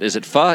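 "pho" said as "fuh"; pronunciation variant. Shown as a demonstration. Debating how to say the dish, "pho" as "fuh". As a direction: offer "pho" with an "uh" vowel. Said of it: "nobody can agree how to pronounce it".

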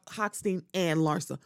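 ordinary talk, nothing marked

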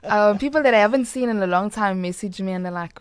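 usual speaking voice

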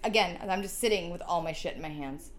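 normal talking voice

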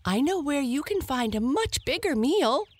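high, fast hare voice; eager hare. A narrator's reading. Hare tempts the lion, high and fast. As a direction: chatter it quickly and high.

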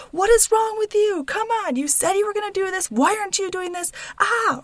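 high-pitched, exasperated self-scolding; mock berating. Mom berating herself for a missed episode, high exasperated scolding. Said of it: "berate yourself".

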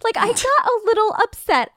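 indignant. Real, not acted. Reliving the water bottle annoyance.

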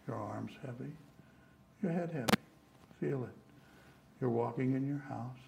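calm, steady hypnosis induction; hypnotist voice. Shown as a demonstration. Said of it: "would you mind testing that on us".

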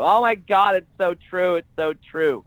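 high, loud gushing fan voice; mock an oblivious excited fan; overexcited fan blurting out; gushing audience member. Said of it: "turn around and go".

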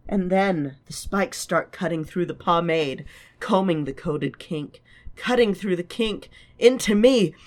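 acting out Lala's dream monologue; theatrical reading of a character; dramatic reading. Reads Lala's monologue aloud.

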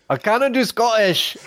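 hammed-up Scottish accent, pitched high; comically overdone Scottish accent; attempted Scottish accent. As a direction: do a mock Scottish wail. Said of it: "read this with a Scottish accent".

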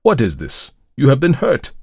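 alarmed, building to a high, loud exclamation; startled priest's outcry; startled cry. A narrator's reading. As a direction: exclaim in shock at a friend's injury. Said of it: "cried the startled priest".

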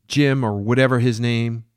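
a mad woman serving the captain breakfast; Jane. Acted. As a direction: play her as doting and unhinged. Jane voiced as a character.

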